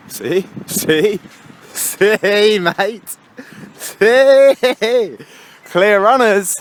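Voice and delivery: triumphantly pointing out the clear road; excited building "see". Real, not acted. Showing viewers the empty road, excitedly.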